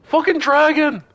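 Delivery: high, loud mock scream of terror; hammed-up panicked high yell; imitated demon scream. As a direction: shriek it like a demon fleeing in panic. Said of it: "send demons screaming, running".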